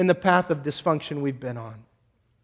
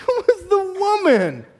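much higher, slow, laughing whine; 5 dB louder, slower than usual. Retold or imitated. Adam to God, repeating the excuse in a laughing high whine. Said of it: "He goes".

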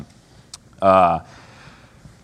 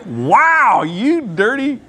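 mock shocked mom; 9 semitones higher, much slower than usual. Voicing a shocked mom at table.

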